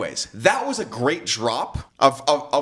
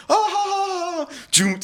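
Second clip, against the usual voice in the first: very high, held "ah" breaking into ha-ha; high-pitched hammed-up laughing whoop; whooping mock laugh; slower than usual. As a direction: whoop it out like a thrill ride. Acts out attraction as thrill ride.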